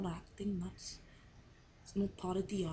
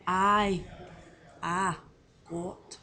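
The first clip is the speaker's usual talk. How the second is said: favourite words in an attempted Scottish accent; Scottish word drill, one at a time; 6 dB louder, much slower than usual. Shown as a demonstration. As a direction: recite each word separately with Scottish vowels. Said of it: "I'm gonna say my favourite words".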